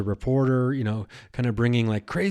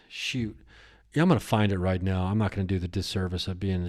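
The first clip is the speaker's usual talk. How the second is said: ordinary talk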